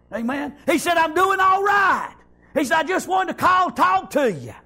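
high-pitched, shouted retelling of a phone call; excited preaching. Retold or imitated. Preacher retells an old friend's call to the congregation, excited preaching. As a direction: preach the remembered conversation at a high, ringing pitch.

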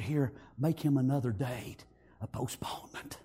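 low, soft instruction to the clerk; hushed judge. Retold or imitated. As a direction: drop to a low near-whisper as the judge. Acting the judge's quiet order.

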